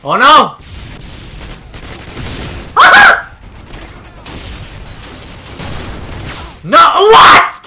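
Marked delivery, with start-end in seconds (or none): 0.6-6.6 high breathy laugh. Real, not acted. Reacting to the game.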